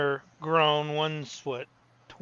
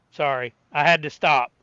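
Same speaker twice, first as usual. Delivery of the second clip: normal talking voice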